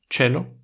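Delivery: chelo with a ch sound; Latin C as ch. Shown as a demonstration. Showing C before E as ch. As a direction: say chelo with a Spanish ch. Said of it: "se pronuncia como la che".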